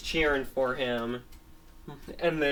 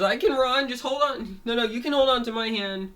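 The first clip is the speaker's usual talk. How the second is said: insistent protest; 10 semitones higher, 4 dB louder than usual. Acted. Dog insists he can run.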